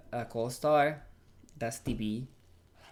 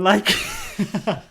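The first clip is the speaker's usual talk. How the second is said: louder, higher, breaking into laughter; laughing; 8 semitones higher, 11 dB louder than usual. Real, not acted. Actor telling host everybody kept laughing at her, laughing. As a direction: say it through a laugh.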